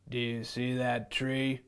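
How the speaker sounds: put-on the lion shows the boy a tree, low. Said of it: "said the lion".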